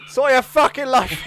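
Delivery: loud, high shout with laughter; laughing outburst; mock outraged reply. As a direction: yell it as a blunt comic telling-off.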